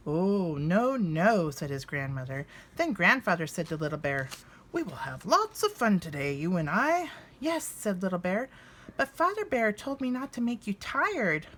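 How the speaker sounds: lively storybook narration, voicing each bear; reading to children. A narrator's reading. Reading Little Bear's Visit to viewers, warm storybook narration. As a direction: read it aloud like a picture book at bedtime. Reads a children's book aloud.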